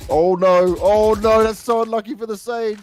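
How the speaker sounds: high, loud "oh no"; excited dismay. Real, not acted. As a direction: wince at the unlucky death. Caster reacts to a death.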